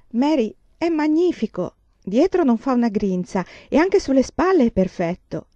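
high, loud and thrilled; bursting with delight; delighted admiration. Acted. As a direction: gush over the perfect fit. Laura admires the fitted dress.

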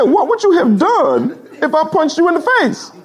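high, slow imitated demand; crazy driver voice. Driver taunts pastor about punching him, imitated crazy voice. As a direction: draw it out in a very high voice.

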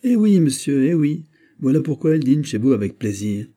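heated. Acted. Steward insists to his master, heated and loud. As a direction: burst out, fully worked up. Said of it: "qu'il s'échauffait".

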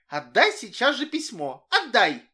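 snatch at it, furious; angry demand. A narrator's reading. Girl demanding letter angrily.

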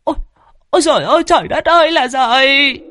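high, loud cry of horror; terrified shriek. A narrator's reading. Old woman in the kitchen shrieking in terror. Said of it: "rú lên".

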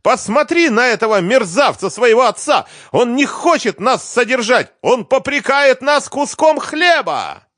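very high, loud screamed summons and accusation; enraged mother yelling at full pitch; hysterical shouting. A narrator's reading. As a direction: shriek for the child to come and witness. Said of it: "продолжала кричать Лиза".

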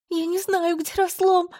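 very high, sobbing shout; angry crying. A narrator's reading. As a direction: cry out in despair. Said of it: "рыдая, прокричала я".

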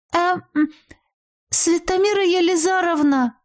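high-pitched, hesitant call to the teacher. A narrator's reading. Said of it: "позвала я". (неуверенно зовёт, высоким голосом)

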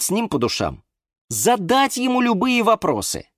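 high, strained, insistent reasoning; patience running out. A narrator's reading. Юра urges the cactus to meet Валера, strained patience. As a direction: plead reason while losing patience. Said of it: "У него явно заканчивалось терпение".

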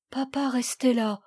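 plain reading voice

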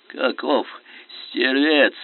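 plain reading voice

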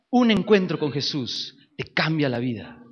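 normal talking voice

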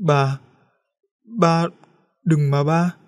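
put-on a scared girl begging her father; trembling plea. A narrator's reading. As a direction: beg him, voice shaking with fear. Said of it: "Lam run rẩy".

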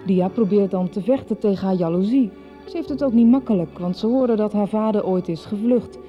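usual speaking voice

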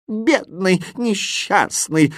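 high, slow moan of pity; theatrical high-pitched moaning; moaning lament. A narrator's reading. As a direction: wail pityingly, drawing the words out. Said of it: "застонал восклицательный".

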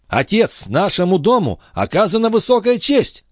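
high-pitched, elevated proclamation; excited announcement. A narrator's reading. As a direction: announce great news to a father, pitch high. Son announces great honour. (торжественно и взволнованно)

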